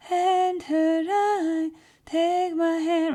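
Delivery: high, slow airy sung head voice; breathy head voice. Shown as a demonstration. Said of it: "they have kind of a very breathy head voice".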